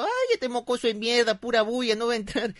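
high-pitched grumbling granny; dismissive grandmother. Retold or imitated. Grandmother thinking the brat is all noise, high dismissive voice. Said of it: "La abuela abrió la ventanita pensando".

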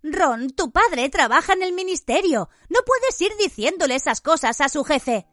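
put-on scolding girl. A narrator's reading. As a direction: warn him off firmly. Hermione voiced, scolding.

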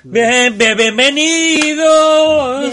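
very high, drawn-out welcome; high mock welcome. Mock high intro greeting.

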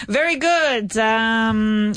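normal talking voice

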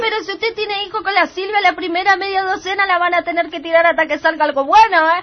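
high, loud insulting greengrocer voice; high offended Teresa. Acted. Teresa insults the family and leaves, high and loud. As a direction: pitch up, sneer, then storm off.

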